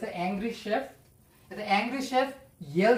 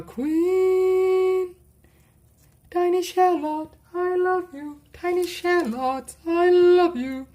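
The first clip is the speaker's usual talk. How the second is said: higher, adoring gush; 7 semitones higher, 4 dB louder than usual. Acted. Cook gushing to a shallot, excited and high. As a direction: swoon over the shallot.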